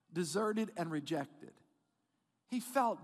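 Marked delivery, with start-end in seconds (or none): none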